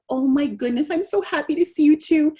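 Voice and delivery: put-on high-pitched baby talk; delighted mommy. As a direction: gush happily to your baby. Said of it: "mommy says".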